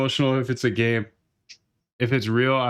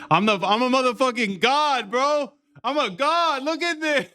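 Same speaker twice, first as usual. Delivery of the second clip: much higher, gloating god voice; hyped bragging; 12 semitones higher than usual. Real, not acted. Streamer gloating over his winning trade, wildly excited. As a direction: crow it like you just won big. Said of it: "I'm already hyped".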